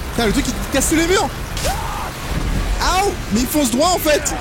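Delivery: high, panicked streamer yelling; freaking out as the monster chases; scared horror game streamer. Real, not acted. Monster smashing walls, chasing him.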